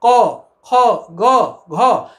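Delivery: क, ख, ग, घ each said clearly and separately from the throat; throat letters modelled for students to repeat; demonstrating letters. Shown as a demonstration. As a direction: say क, ख, ग, घ slowly so the students can follow. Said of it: "आप बोलिए".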